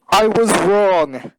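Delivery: slow, loud, ominous; dramatic declaration. Real, not acted. Telling the podcast co-host how the review went, a slow loud doom line. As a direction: land the twist like a doom line.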